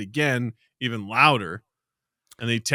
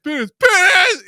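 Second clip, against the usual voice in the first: much higher, building to a loud yell; escalating game shout; 7 dB louder than usual. Shown as a demonstration. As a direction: escalate into a high holler. Demonstrating the escalating penis game.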